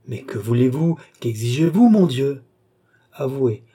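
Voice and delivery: indignant appeal; exasperated plea. A narrator's reading. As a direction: build to a loud appeal on MON DIEU. Cornered patient protests to doctor.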